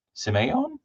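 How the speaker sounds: checking "sim-AY-on" for Simeon as a high question; questioning pronunciation. Real, not acted. Streamer asking if the name is right, questioning pronunciation. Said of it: "Is that right?".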